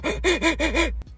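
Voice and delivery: high, stuttering sob breaths; mock hysterical crying gasps; imitated sobbing gasps. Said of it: "who's about to go".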